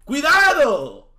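high, loud, drawn-out cry; shouted warning. Real, not acted. Streamer warning chat about his sacrifice, shouted warning. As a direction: stretch the warning out loudly.

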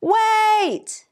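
high, drawn-out storybook yell. A narrator's reading. Centipede halting the song to add a beat, yelled. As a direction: call out high to stop everyone. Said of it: "yelled Centipede".

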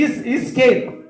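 "isqueiro" slowly, loud, first syllable split off; loud, drawn-out demonstration; slow word drill. Shown as a demonstration. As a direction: spell out "Is-" then "isqueiro" for a slow learner. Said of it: "vou tirar o microfone".

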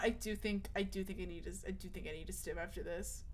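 fading. Real, not acted. Overstimulated, trailing off.

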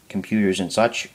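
ordinary talk

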